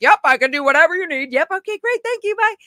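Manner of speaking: high-pitched, rushed eagerness; overeager yes. Retold or imitated. Young job-seeker saying yes to everything, overeager. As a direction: rattle it off high, desperate to please.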